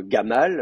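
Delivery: GAMAL opening on a hard g; Egyptian hard g. Shown as a demonstration. Teacher comparing one name across dialects, hard g in GAMAL. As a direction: say "Gamal" with a plosive g. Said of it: "prononcée de trois façons différentes".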